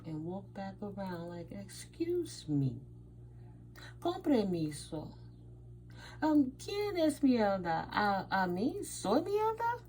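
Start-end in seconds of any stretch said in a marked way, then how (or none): 1.5-9.8 slow, pointed then rising high with outrage; mock indignant confrontation. Confronting the man who called her mierda, indignant and high. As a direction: repeat his insult back at him, incensed.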